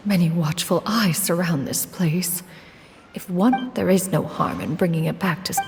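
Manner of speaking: soft, low musing to oneself; hushed aside. Acted. Wary of many watchful eyes, a hushed aside. Character muses to herself about watchers.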